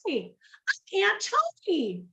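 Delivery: high-pitched, drawn-out hearty greeting; effusive high-voiced welcome; Aunt Tony. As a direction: greet a stranger in a big, high voice. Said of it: "this lady opens the door and she goes".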